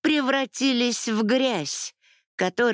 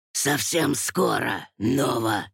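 menacing villain; 8 semitones lower than usual. Acted. Villain Amon threatens Nova.

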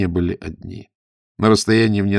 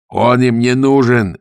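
loud, drawn-out higher call; shout; 5 semitones higher, 6 dB louder, slower than usual. A narrator's reading. Gonzalin shouts to Zagorsky about the monk, loudly. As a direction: shout it slowly across to your companion. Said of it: "крикнул Гонзалин Загорскому".

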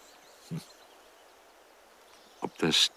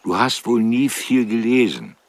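louder repeat; 6 semitones higher, 6 dB louder than usual. Acted. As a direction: say it louder for a hard-of-hearing old woman. Repeats question after Wie bitte. (lauter wiederholt, damit sie es versteht)

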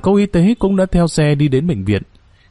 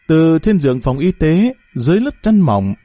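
plain reading voice

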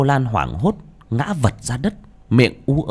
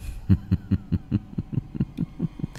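breathy, quiet demonic laugh; soft possessed cackle; evil laugh; 3 semitones lower, 10 dB quieter than usual. Acted. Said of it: "giọng âm u".

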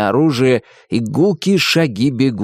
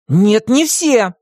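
stubborn objection; 11 semitones higher, 4 dB louder, much slower than usual. A narrator's reading. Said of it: "возразил Тим".

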